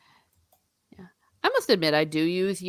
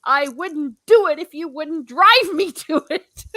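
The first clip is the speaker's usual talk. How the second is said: retold retort; 11 semitones higher, 8 dB louder than usual.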